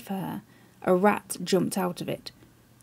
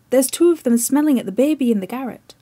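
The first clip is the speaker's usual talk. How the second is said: loud, fast, higher cry of alarm; crying aloud; 4 semitones higher, 6 dB louder, faster than usual. Acted. Chips crying aloud at his club about the rats, loud alarmed cry. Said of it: "he would cry aloud".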